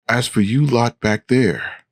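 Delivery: understated call back to the carriage. A narrator's reading. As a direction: call lightly to passengers behind you. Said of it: "I call out to the carriage".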